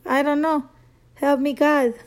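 ordinary delivery

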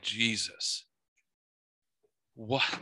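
soft, stunned murmur. Retold or imitated. A man on his porch, murmuring in stunned disbelief. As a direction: murmur it, dumbfounded. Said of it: "I was like".

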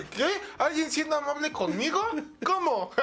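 mock incredulous. Someone is kind to him, hammed-up high incredulity. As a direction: act stunned that anyone is kind. Acted reaction to kindness.

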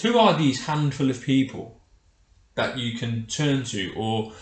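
ordinary delivery

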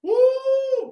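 high shouted holler of joy; exultant preacher whoop; ecstatic woo. Real, not acted. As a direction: let out a joyful church holler.

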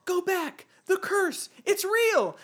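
high, loud mock child's warning; hammed-up kid from a spooky ride; panicked kid. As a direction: cry out a warning like a little boy. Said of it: "the first thing you see is this kid".

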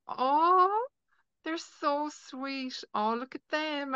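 high, slow, melting coo; gushing. Retold or imitated. An admirer watching a sweet couple, high gushing coo. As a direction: gush over the couple, drawing out every word. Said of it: "the person that'll be like".

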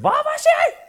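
mock shrill shout. Mimicking shouting woman.

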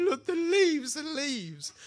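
high-pitched, hammed-up amazement; comic high voice, carried away with awe; mock overwhelmed wonder. Acting overwhelmed wonder at leaves.

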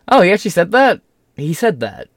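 high, loud disbelief; surprised. Real, not acted. Reacting to the kneecaps quote with disbelief. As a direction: jolt up in astonishment.

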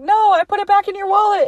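rushed retold comeback; defensive protest. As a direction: blurt out an imitated excuse, pitch up. Retold quarrel, defensive denial.

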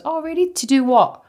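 high, mimicked nosy questioning; prying colleague. Colleague asking about evening plans, nosy. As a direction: mimic someone digging for details.

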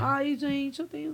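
soft, quiet imitation of her whine; trailing mock-girlish video voice; imitated video voice. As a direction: mimic her voice from the viral clip, softly. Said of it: "Ela fala assim".

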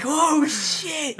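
high, drawn-out gasp of amazement; shocked reaction. Retold or imitated. Host reliving the spider-trick scene, amazed. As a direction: let it swoop up high, half laughing.

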